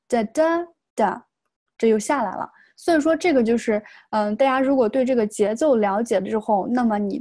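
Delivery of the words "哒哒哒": "哒哒哒" slowly, weak, strong, then dropping away; up-then-down stress pattern. Shown as a demonstration. Teacher showing stress rhythm, "哒哒哒" rising then falling. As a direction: step up on the middle 哒, down on the last. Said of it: "如果这个地方下来".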